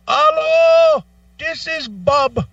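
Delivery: high, slow, sing-song agent greeting; mock phone agent. Support agent answering the caller, mock sing-song greeting. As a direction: put on the helpline rep picking up the call. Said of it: "I call over to Amazon".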